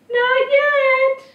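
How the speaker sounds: put-on very high, loud child voice; singsong high boy impression. A narrator's reading. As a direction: call back in a little boy's pipe. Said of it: "in the voice of the boy".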